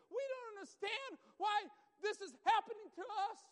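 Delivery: loud, building fervent sermon; preaching. Acted. Preaching at full force.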